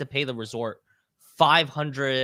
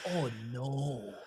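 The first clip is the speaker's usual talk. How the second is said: softer sinking oh no; quiet dismay; 12 dB quieter than usual. Retold or imitated. Traveler to himself, soft sinking dismay. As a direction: let the realization deflate you.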